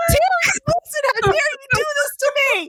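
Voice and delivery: high, tearful-sounding fangirl shriek; hammed-up high squeal of outrage; mock outraged wail. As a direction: cry out to the author in delighted betrayal. Acting out overwhelmed reaction to author.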